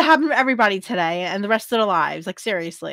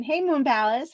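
ordinary talk, nothing marked

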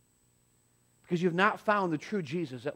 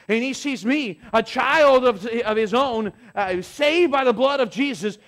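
raised, loud, high preaching cadence; preaching at full force; 7 semitones higher, 7 dB louder than usual. Acted. Pastor preaching salvation to the congregation, raised and fervent. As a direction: bring it home to the congregation, full voice.

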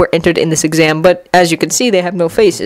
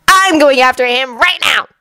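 higher-pitched firm declaration; determined Anko; 6 semitones higher than usual. A narrator's reading. Anko announces her pursuit, high and determined. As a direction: declare it urgently. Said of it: "Kakashi corrected".

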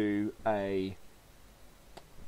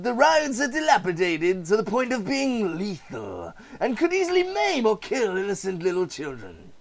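higher, sneering salesman voice; haggling over a derelict amusement park, smug; smug sly dealmaker; 11 semitones higher, 6 dB louder than usual. Acted. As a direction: gloat over the bargain. Voices park owner and buyer.

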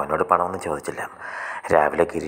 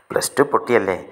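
higher, louder teasing question; slight mockery; 5 semitones higher, 5 dB louder than usual. A narrator's reading. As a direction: needle lightly with a mocking question. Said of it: "അവൻ്റെ ചോദ്യത്തിൽ ചെറിയ പരിഹാസമുണ്ട്". (ചെറിയ പരിഹാസത്തോടെ)

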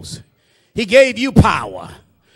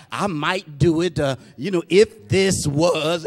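low, soft, hesitant waffling; noncommittal, halting mumble; hemming and hawing; 5 semitones lower, 3 dB quieter than usual. Acted. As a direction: stall and drag as if unsure of committing. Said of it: "hemming and hawing".

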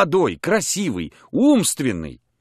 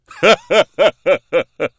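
a loud burst of laughter; hearty laugh; 7 semitones higher, 7 dB louder than usual. Acted. The captain laughing loudly at his own joke. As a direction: let a big laugh break out.

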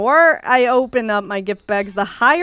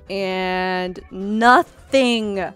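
drawn-out letdown; 2 semitones lower, much slower than usual. Real, not acted.

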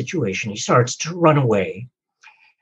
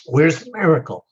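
retold yell; 6 semitones higher than usual. Man under a bear yells to God, mild mock yell. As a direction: yell it out as a joke-teller would, half-hearted. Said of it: "the guy yells out".

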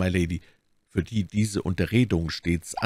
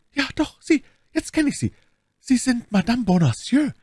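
much higher, urgent revelation; excited recognition; 4 dB louder than usual. Acted. Milady unmasks the novice, high and excited. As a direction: pounce on her identity.

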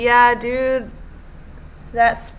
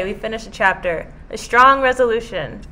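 ordinary delivery

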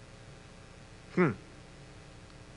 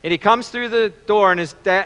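ordinary talk

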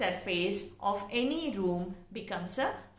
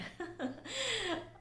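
real high, light laugh; amused laugh; 11 semitones higher, 8 dB quieter than usual. Real, not acted. Teacher amused by English being a funny language, laughing. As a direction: laugh softly and high, tailing off.

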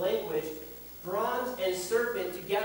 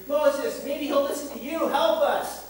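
higher, louder cry for help to Moses; frightened crowd begging Moses, higher and louder; desperate plea; 7 semitones higher, 4 dB louder than usual. Retold or imitated. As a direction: plead like a people dying in the desert. Israelites crying out to Moses.

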